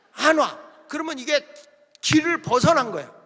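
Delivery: loud, high-pitched sermon delivery; forceful preaching. Real, not acted. Preacher scolding those who skip revival meetings, forceful preaching. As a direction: drive it home to the congregation.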